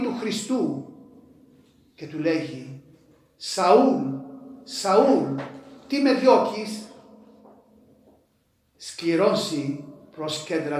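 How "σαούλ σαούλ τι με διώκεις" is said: high, loud call of the name; calling voice from heaven. Acted. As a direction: cry out to Saul from above. Preacher voices Christ calling Saul.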